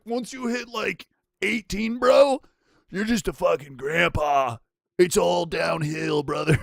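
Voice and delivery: high, loud mocking kid voice, stretched out; mock a twelve-year-old's know-it-all verdict; imitating brain-rotted kids. Streamer mimicking brain-rotted twelve-year-olds, mocking. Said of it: "They're like".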